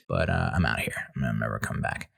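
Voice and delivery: low, deep mock exit; mock low farewell. Tapping out to the waxer, a low mock farewell. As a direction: drop the voice to quit. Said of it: "be like".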